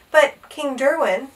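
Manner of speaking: plain reading voice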